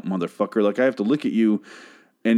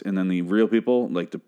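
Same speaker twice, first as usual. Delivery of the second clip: ordinary talk, nothing marked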